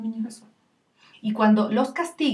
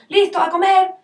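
high, loud hurried exchange; acted family squabble; 10 semitones higher, 9 dB louder than usual. Mother calls dinner, child begs for five minutes, hurried squabble. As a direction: call out abruptly, then plead quickly. Acts abrupt call and child's plea.

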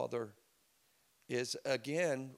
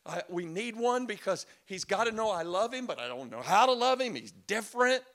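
higher, louder pleading prayer; fervent prayer; 8 semitones higher, 7 dB louder than usual. Retold or imitated. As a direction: beg God for a breakthrough. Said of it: "And I'm praying".

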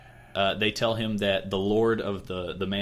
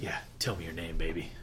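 lower, softer suave pickup line; mock smooth talker; 4 semitones lower, 7 dB quieter than usual. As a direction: purr it like a cheesy flirt. Cocky smooth-talker version.

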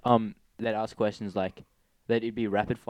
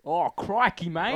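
loud, high Aussie exclamation; exaggerated Australian swearing; 8 semitones higher, 4 dB louder, much slower than usual. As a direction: ham up the Australian accent. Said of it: "turn it into a Australian swearing".

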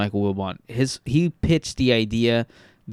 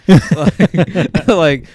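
chuckling through the words; loud laugh spilling into speech; laughing while talking; 5 semitones higher, 10 dB louder than usual. Real, not acted.

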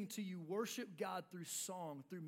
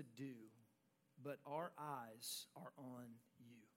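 soft reading; 10 semitones lower, 12 dB quieter, slower than usual. Acted. Pastor reads the key verse softly.

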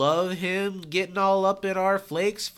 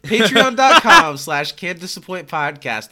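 announcer plug; 9 dB louder than usual. Pretend.

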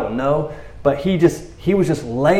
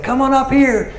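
a touch of high mock dare; lightly voiced taunt; 12 semitones higher than usual. Preacher quoting the garrison's dare, light high taunt.

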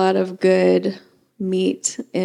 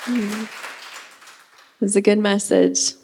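normal talking voice

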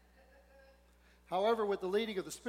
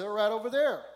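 mother pointing out; 4 semitones higher, 10 dB louder than usual. Retold or imitated. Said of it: "But a mother says".

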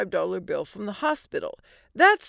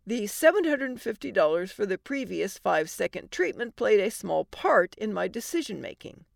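plain reading voice

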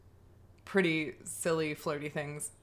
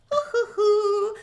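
giddy hooting; 14 semitones higher, 8 dB louder than usual. Retold or imitated. Giddy van departure.

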